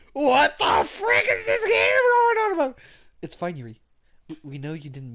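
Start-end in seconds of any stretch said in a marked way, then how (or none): 0.1-2.8 very high, loud crying-out yell, slow; exasperated shout. Real, not acted. Player bewildered by the game text, high slow exasperated yell. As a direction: wail it at the screen.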